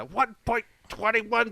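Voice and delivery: high, slow movie-quote impression; Christopher Lloyd. Podcaster quotes the actor's famous line, high impression. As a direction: draw each word out, pitched high. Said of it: "I don't do a Christopher Lloyd impression".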